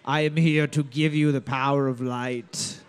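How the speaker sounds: low dark lord voice. Acted. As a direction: boom it like a villain. Voices cosmic figure.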